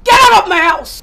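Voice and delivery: mother ordering the teacher out, shouting; angry shout. Acted. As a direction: order her out at full voice.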